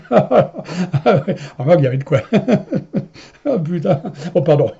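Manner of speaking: chuckling through the words; laughing. Real, not acted. Recalling his friend's shock, laughing. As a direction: laugh while you admit it.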